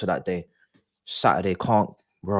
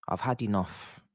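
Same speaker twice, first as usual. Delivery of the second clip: "had" said quietly, energy gone; deflated, softer; 7 dB quieter than usual. Real, not acted. Fan giving up on his team, deflated mutter. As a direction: let it fall, worn out.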